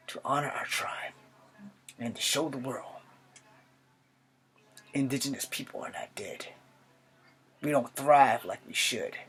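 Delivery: put-on measured, earnest young soldier with long pauses between phrases; Charlie Iron Knife. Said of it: "I'm going to be a character from G I Joe named Charlie Iron Knife".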